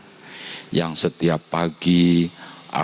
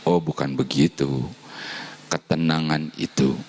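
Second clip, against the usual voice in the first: ordinary talk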